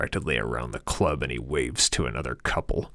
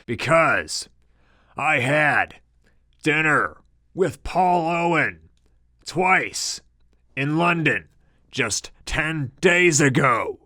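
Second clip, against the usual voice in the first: loud, higher, slow and deliberate; shouting back; 11 semitones higher, 5 dB louder, slower than usual. A narrator's reading. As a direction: shout each word over the club din. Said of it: "shouts back".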